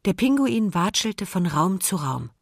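ordinary delivery

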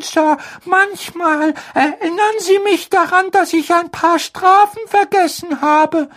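very high, timid elf voice; a fretful little house-elf; squeaky house-elf voice. Acted. Dobby the house-elf speaks.

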